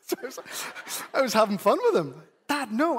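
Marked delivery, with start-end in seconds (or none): 2.5-3.0 put-on exasperated child. Son corrects teasing dad.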